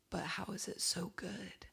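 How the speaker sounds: slow, hushed whisper of disbelief; awed whisper. Acted. Marvelling at [name]'s bedroom recording, in an awed whisper.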